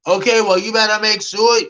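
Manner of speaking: high, loud mock sass; ridiculous sassy retort. Imitating herself warning the guy to call back, loud and sassy. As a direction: fire it back like a jealous girlfriend with a warning. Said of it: "I was kind of a little bit, um, ridiculous".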